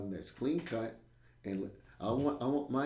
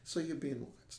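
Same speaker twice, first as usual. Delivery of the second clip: quiet ominous hint; hushed; 4 semitones higher, 7 dB quieter than usual. Shown as a demonstration. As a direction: say it softly, like a warning. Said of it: "repeated as a whisper".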